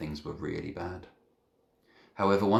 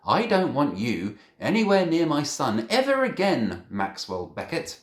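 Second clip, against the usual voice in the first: angry mother; 9 semitones higher, 8 dB louder than usual. Acted. Angry mother warns boy off.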